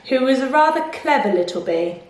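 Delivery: plain reading voice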